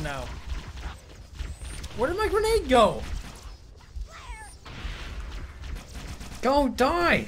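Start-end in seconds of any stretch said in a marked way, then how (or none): 2.0-7.3 high, urgent panic; frantic high yelp. Real, not acted. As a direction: blurt it out in a panic. Panicked game moment.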